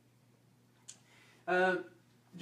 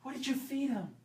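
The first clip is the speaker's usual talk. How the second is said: high accusing question; 11 semitones higher than usual. Retold or imitated. Imitating accusing parent.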